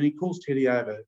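normal talking voice

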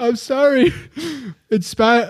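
high voice breaking into laughter; giggling apology. Real, not acted. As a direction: try to read through uncontrollable giggles. Breaking up during read.

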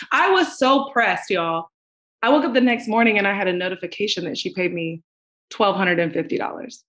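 ordinary delivery

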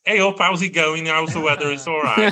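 broad Stoke northern accent, greeting her parents; northern English accent. Shown as a demonstration. Chatting to her parents on Skype, in broad northern Stoke accent. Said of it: "my native northern accent will come out".

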